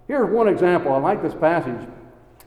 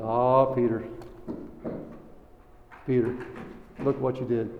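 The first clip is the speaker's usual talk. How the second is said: a lower, hushed, grieving voice; gentle rebuke; 5 semitones lower, 7 dB quieter than usual. Acted. Jesus speaking sadly to Peter after the denial, softly.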